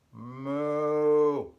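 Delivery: long, loud, drawn-out cow moo. Acted. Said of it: "and the cow says".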